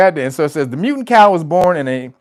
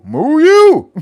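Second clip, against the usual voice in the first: louder, higher moo that tops the last one; cow moo comeback; 7 semitones higher, 7 dB louder than usual. Retold or imitated. Cow answering its rival, loud mock moo. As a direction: win the moo battle with a big moo. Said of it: "them motherfuckers is moo battling".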